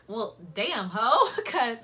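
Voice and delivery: loud laughing exclaim; loud laughing mock surprise. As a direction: shout it out through laughter, playfully shocked. Reacts to his age, laughing.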